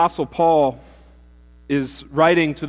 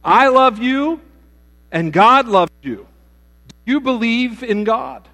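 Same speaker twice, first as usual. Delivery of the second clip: high, loud, earnest rescuer voice; eager rescuer; 10 semitones higher, 9 dB louder than usual. Acted. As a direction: plead warmly with him, pitched high. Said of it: "The other gentleman ... said".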